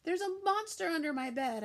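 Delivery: high, loud alarmed yell; girl yelling a warning to grandma. A narrator's reading. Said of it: "I yelled".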